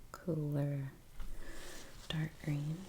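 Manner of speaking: usual speaking voice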